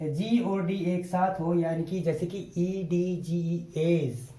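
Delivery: ordinary talk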